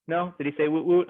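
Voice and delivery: ordinary delivery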